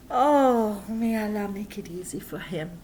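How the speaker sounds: usual speaking voice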